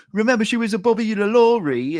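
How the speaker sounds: high, loud English movie-character voice; mock Cockney accent. Co-host quoting Austin Powers rhyming slang, high mock Cockney. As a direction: put on a high, hammy Cockney. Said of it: "have you ever seen Austin Powers Goldmember".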